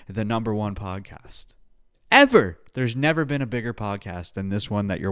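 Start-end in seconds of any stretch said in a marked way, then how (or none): 2.1-2.6 high, loud boast on Ever; hyped declaration. Acted. Podcaster bragging to viewers, high loud hype. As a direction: sell it like an announcer.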